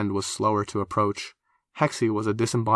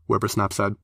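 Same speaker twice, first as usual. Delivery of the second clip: usual speaking voice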